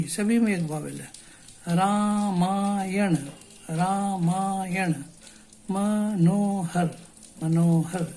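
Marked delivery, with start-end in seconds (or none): 1.7-6.9 Darbhanga and Vaishali drawn out slowly; teacher breaks place names slowly into syllables. Shown as a demonstration. Slow syllable demo. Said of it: "उसको slowly तोड़ते हुए".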